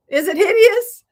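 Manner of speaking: fake-panic asking the viewers; playful alarm. Real, not acted. Asks viewers about her bow.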